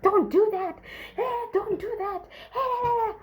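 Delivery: frantic pleading. Retold or imitated. Kuda begging his wife through the door, high and crying.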